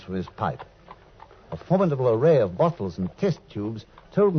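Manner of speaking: measured, reflective storyteller reading; story narration. A narrator's reading. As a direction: narrate it like recalling an old case.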